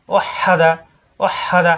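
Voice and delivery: ওয়াহাদা with a long, pressed h in the middle; doubled, held h in ওয়াহাদা; pressed h sound. Shown as a demonstration. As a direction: lean hard on the হা in ওয়াহাদা. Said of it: "high চাপ দিয়ে".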